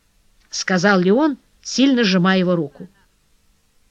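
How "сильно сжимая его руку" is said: plain reading voice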